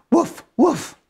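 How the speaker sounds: high yappy woofs; puppy barking. Acted. Storyteller voicing Biscuit answering the girl, high puppy barks. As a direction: bark like a tiny puppy.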